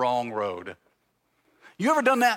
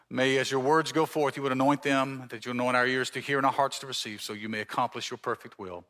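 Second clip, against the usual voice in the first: prayer; 5 semitones lower, 4 dB quieter than usual. Acted. Opening prayer before sermon.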